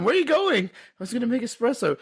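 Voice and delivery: high, fast quoted movie lines; playful movie quote. Retold or imitated. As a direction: toss it off playfully to your wife. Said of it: "I say it to my wife".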